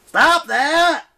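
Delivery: very loud, high anguished cry; wailing. Acted. Leo begging the turtle to stop, high loud wail. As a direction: wail it in despair. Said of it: "Leo wailed".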